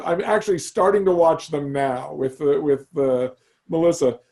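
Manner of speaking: ordinary talk, nothing marked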